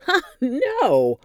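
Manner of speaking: laughing burst. Acted. Janet laughing off Dom's question, loud. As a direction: bark it out amused.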